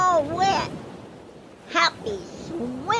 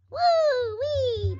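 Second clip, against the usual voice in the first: elephant trumpet imitation; 8 semitones higher than usual.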